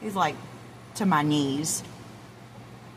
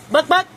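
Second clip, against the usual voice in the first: high clucking imitation; chicken cluck; 10 semitones higher than usual. Owner clucking to call the rooster. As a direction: cluck at them.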